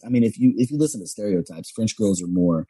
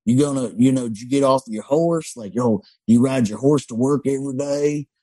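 mock cowboy greeting; 5 semitones higher than usual. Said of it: "they're like".

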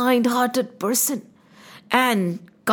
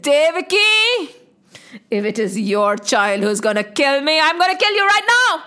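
angry. Acted. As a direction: threaten your sister in rage. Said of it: "They were red with anger".

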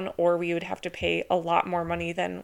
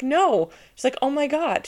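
retold amazed neighbor; 6 semitones higher, 5 dB louder than usual.

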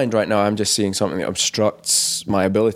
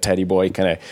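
usual speaking voice

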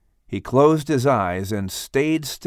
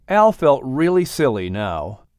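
ordinary delivery, nothing marked

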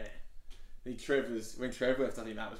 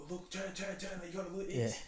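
higher, eager calls to turn and look; excited urging; 8 semitones higher than usual. Retold or imitated. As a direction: nudge her, can't believe what's on screen. Urging girlfriend to watch TV.

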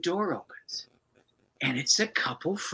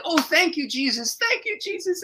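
high joyful thanks; overflowing gratitude, voice lifted high; tearful praise. Retold or imitated. Couple on their knees thanking Jesus, high and joyful. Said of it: "They said".

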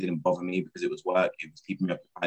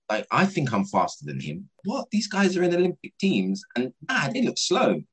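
much higher, squeaky disbelief; retold inner shock, pitched way up; incredulous thought; 10 semitones higher than usual. Shocked thought watching Olympic athletes.

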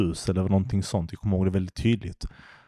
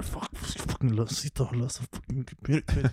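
breathy, hushed frustrated grumble under the breath; muttered curse; 4 semitones higher, 6 dB quieter than usual. Retold or imitated. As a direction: mutter the swearing to yourself, half voiceless. Retold frustrated inner thought.

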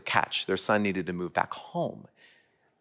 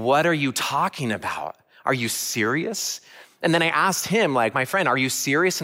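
higher and louder, disbelieving questions; incredulous; 6 semitones higher, 4 dB louder than usual. Retold or imitated. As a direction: ask like you can't believe it. Retold disbelief at the offer.

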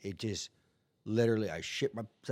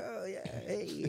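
cheerful cellmates; 10 semitones higher, 5 dB quieter, slower than usual. Retold or imitated.

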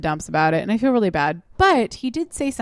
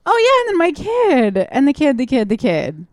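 mocking a doting parent; 6 semitones higher, 6 dB louder than usual. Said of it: "that person's like".

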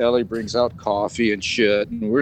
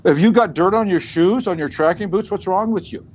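higher, emphatic telling-off; retold scolding; 6 semitones higher, 5 dB louder than usual. Team member warning the dudes about dirty shoes, acted scolding. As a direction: scold them in a raised pitch, acting it out.

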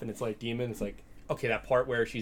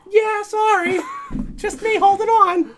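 very high, loud, laughing falsetto; squeaky falsetto; 11 dB louder, slower than usual. Pretend. Voicing guy through laughter.

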